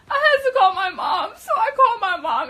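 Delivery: very high, loud, laughing cry; shrieky laughing confession at the top of the voice; laughing wail. Real, not acted. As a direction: wail it through real laughter. Recalls being scared, laughing.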